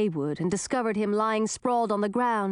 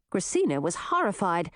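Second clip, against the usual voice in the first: ordinary delivery, nothing marked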